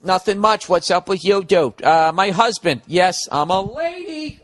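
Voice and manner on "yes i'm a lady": high, drawn-out "lady", playfully hammed up; playful, slow announcement of being a lady; mock fanfare. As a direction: stretch LADY slowly and send it up high. Mock-announcing that writer is female.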